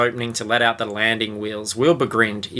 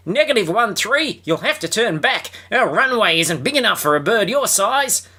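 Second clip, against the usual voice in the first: high shouted radio order; shouted warning; 10 semitones higher than usual. Acted. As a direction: yell it into the microphone. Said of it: "[name] shouted".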